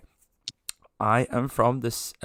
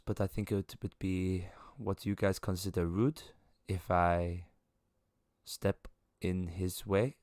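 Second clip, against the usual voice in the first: lightly voiced formal figure, measured and softer; formal aloof woman; 6 dB quieter than usual. She leaves him to explain ground rules, quiet formal aloofness. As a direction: decline politely, quiet and detached.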